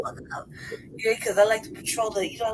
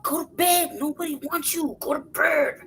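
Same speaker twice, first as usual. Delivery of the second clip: shooing; 8 semitones higher than usual. Retold or imitated. Shooing the peacocks.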